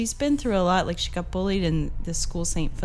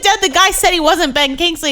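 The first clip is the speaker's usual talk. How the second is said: mock exasperated protest; 11 semitones higher, 11 dB louder than usual. Daughter retells correcting her dad.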